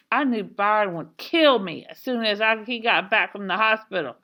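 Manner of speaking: usual speaking voice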